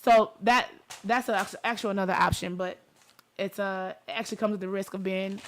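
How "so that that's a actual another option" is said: ordinary talk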